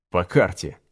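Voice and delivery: a low, dry scoffing quip; dry smirk. A narrator's reading. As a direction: toss it off with a smirk. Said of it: "хмыкнул Федотов".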